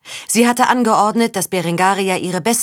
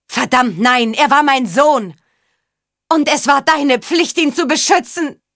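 high, loud outburst building higher; anguished accusation; 7 semitones higher, 4 dB louder than usual. A narrator's reading. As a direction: rage at him, climbing toward a scream. Grieving mother accuses the father. (verzweifelte Wut, immer höher geschrien)

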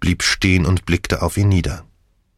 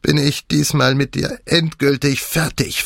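higher, hateful snarl of a dying man; spiteful, higher-pitched sneer at his wife; 7 semitones higher than usual. A narrator's reading. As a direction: spit the words out with venom. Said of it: "fauchte er gehässig".

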